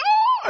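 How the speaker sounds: short, very high mock scream; loud put-on shriek; acted movie scream. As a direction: cry out like a fleeing horror-film demon. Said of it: "And he should say".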